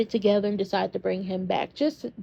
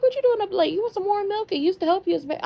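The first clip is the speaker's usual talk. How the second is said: higher, sweet motherly coo; doting mother; 8 semitones higher than usual. Retold or imitated. Mother asking her late-up daughter, high coo. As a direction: play the mom offering warm milk. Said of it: "and Carly was like".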